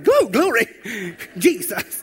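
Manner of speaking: high, loud, breaking into a laugh; excited call-out. Retold or imitated. Congregation member calling out during the sermon, high excited shout. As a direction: cheer it from the pews. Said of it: "I heard somebody".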